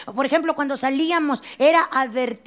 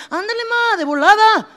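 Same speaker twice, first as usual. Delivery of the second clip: put-on high, loud pleading kid; mock urgent kid begging; whiny child; 3 semitones higher, 6 dB louder than usual. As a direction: whine it like an impatient child. Child urging mother, laughing.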